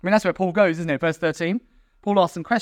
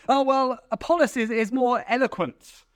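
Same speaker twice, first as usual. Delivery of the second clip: higher-pitched, self-satisfied put-on voice; hammed-up snob, slowing on the last words; snooty superiority; 6 semitones higher than usual. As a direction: play a smug fan defending his favourite. Acted snooty Apollos follower.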